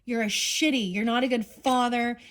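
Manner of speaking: real anger at the absent man; angry. Real, not acted. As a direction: scold him like you're fed up. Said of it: "I'm getting pissed".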